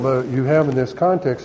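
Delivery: ordinary talk, nothing marked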